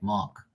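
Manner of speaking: "Mark" with no R, like "Mahk", soft; local pronunciation. Shown as a demonstration. Explaining the local spelling, "Mark" said as "Mahk". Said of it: "that's how people here would spell my name".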